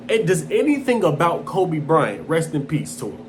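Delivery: ordinary delivery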